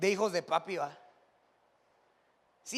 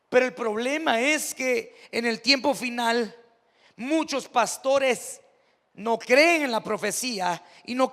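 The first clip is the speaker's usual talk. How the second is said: preaching at full force; 5 dB louder, slower than usual. Acted. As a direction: drive the warning home slowly to the congregation. (predicando con fuerza, lento y más alto)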